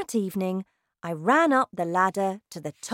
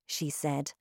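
usual speaking voice

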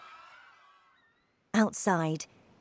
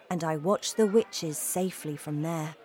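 ordinary delivery

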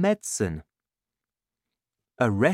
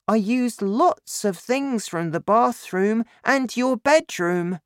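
put-on high-pitched child's voice; young son; 11 semitones higher, 4 dB louder than usual. A narrator's reading. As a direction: recount it to Dad as a small boy. Narrator voices young son George.

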